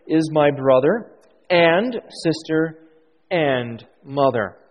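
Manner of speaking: "and" drawn out before "sister" and before "mother"; stress on AND. Shown as a demonstration. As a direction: lean slowly on each "and", pausing before it. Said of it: "I emphasize where the, the word "and".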